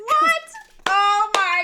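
high, loud shriek of surprise; delighted shriek at the band name; shocked delight. Real, not acted. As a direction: squeal in real delight. Reacting to pun band name.

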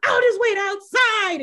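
high, loud, huffy retort; huffy storm-off. Retold or imitated. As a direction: snap it while storming out. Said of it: "goes to storm towards the door".

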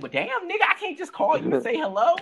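high, squeaky mock outrage at the nephew; indignant retort. Answering the misogynist nephew's crude question, high and exasperated. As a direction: pitch it way up, exasperated and incredulous. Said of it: "I'm like".

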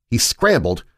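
ordinary delivery

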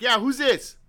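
loud, high annoyed question; irritated demand. Retold or imitated. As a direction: demand it impatiently. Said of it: "I'm all pissed off".

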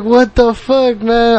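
slow, high, stunned what the fuck; a worker who can't believe what's asked of him; exasperated disbelief. Retold or imitated. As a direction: drag it out in disbelief. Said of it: "I'm like".

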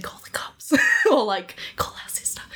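urgent whisper; whispered plea to her mum. Retold or imitated. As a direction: breathe it so the scammer can't hear. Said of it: "she quietly went and woke her mum up and said".

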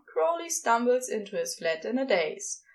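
plain reading voice

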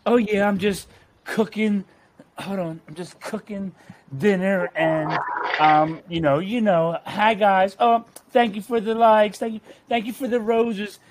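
imitating a ditzy live streamer; mocking TikTok streamer. Mocking women who cook on TikTok live, high and sing-song. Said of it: "they'll just sit there and just be like".

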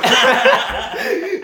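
high burst of laughter. Real, not acted. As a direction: giggle loudly. Laughing at own story.

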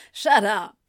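short, dismissive scoff; mildly scornful brush-off. A narrator's reading. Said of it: "Anthony scoffed".

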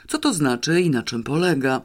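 ordinary delivery, nothing marked

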